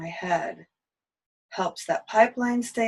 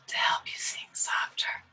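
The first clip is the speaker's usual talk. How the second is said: whispering; 5 dB quieter than usual. Shown as a demonstration. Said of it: "tight-throated whisper".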